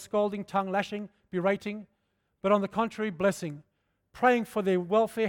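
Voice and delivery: fervent pulpit scripture reading; preached scripture. Acted. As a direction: proclaim the verse to the congregation.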